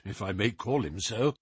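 ordinary delivery

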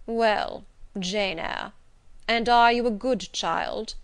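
slow, loud, weighty questioning; solemn stern gentleman. A narrator's reading. Stony stranger testing the little girl, solemn. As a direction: interrogate a child with solemn authority.

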